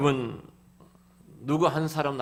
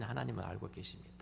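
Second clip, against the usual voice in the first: whisper; 10 dB quieter, faster than usual. Retold or imitated. End of retold prayer.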